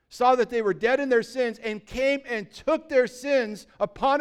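loud, high, driving sermon voice; fiery preaching. Acted. Pastor preaching hard to his church, loud and high.

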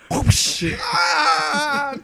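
high yelp as he falls; falling scream. Acted. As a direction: cry out while falling. Falling out window, cry.

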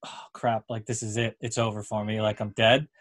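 low, quiet mock resignation; resigned. Teammate realizing it's over for him, low resigned mutter.

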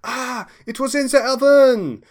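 high, slow French chef voice. Acted. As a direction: play the flustered French chef. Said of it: "he says".

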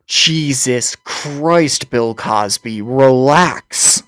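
slow, stretched-out exclamation; appalled exasperation. Real, not acted. Host reacts to co-host's crude explanation, appalled. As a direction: drag the words out in disbelief.

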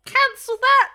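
high, loud official retraction; Tesh calling security. Acted. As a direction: retract the order in a high character voice.

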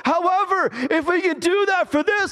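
high, insistent pulpit delivery. Real, not acted. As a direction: press the point high and urgently.

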